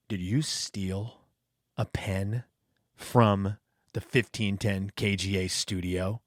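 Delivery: low, soft, slow menace; a low, slow, quiet grilling from a boss-like figure; menacing interrogation. Retold or imitated. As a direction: lean in and threaten him quietly. Furman confronts new hire.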